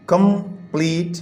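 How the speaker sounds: Complete in two beats, कं-प्लीट; split into syllables. Shown as a demonstration. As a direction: pause between कं and pleat. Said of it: "दो syllable हो गए".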